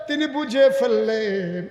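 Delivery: high, loud, drawn-out sermon cry; full-force preaching. Real, not acted. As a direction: stretch the words out over the crowd.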